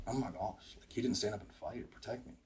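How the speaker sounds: quiet inner thought. Retold or imitated. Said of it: "I remember thinking".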